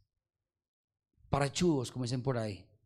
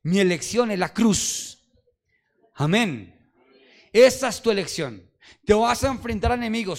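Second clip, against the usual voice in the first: louder rousing preacher cadence; preaching at full force; 6 semitones higher, 10 dB louder than usual. Acted. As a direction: stir up the congregation.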